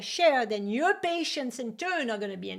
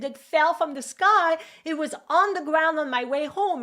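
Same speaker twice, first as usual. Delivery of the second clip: put-on excited child; 6 semitones higher, 5 dB louder than usual. Child showing found bill.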